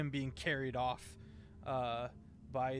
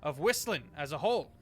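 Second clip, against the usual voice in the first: booming ambassador; 6 semitones higher, 4 dB louder than usual. Acted. Ambassador denouncing Drogar before the council, booming. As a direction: boom it out like a decree. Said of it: "voice becoming much more booming".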